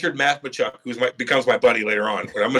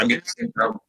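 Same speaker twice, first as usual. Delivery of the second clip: retold despair; 8 semitones higher than usual. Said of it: "I'm like".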